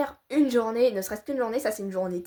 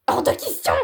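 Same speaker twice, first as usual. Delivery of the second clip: indignant refusal; 6 semitones higher, 7 dB louder than usual. Acted. Victoria refuses loudly.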